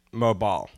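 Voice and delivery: MOBILE as MO-bile; low-pitched British "MO-bile". Shown as a demonstration. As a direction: end Mobile like "mile". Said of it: "I would say mobile".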